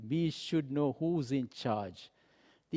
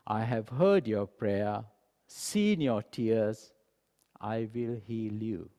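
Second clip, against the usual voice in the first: solemn promise; 4 semitones lower, slower than usual. Acted. God answering a weeping king's prayer, slow solemn promise. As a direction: draw out each phrase with calm authority.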